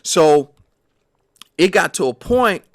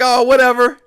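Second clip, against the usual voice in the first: higher, louder, waving it away; imitating a careless shrug-off; mock dismissive; dismissive brush-off; 5 semitones higher, 5 dB louder than usual. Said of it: "I just brushed him off like".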